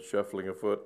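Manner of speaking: usual speaking voice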